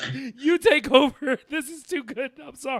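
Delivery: high-pitched, words squeezed between laughs; real laughing fit; cracking up. Real, not acted. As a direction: give up talking through helpless laughter. Too overcome with laughter to continue.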